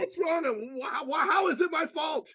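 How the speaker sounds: high-pitched flustered panic; hammed-up panicked husband, voice leaping up; mock panic. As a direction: squawk questions in comic alarm. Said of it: "we panic".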